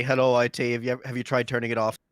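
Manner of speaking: ordinary delivery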